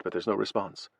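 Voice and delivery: plain reading voice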